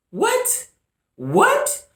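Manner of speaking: very high-pitched, disbelieving "what?"; incredulous. Retold or imitated. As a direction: voice leaping up in disbelief. Acted incredulous reaction to remark.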